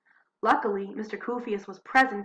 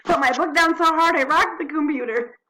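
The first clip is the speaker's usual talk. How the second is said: laughing; 8 semitones higher, 8 dB louder than usual. Real, not acted. Laughing after knocking computer.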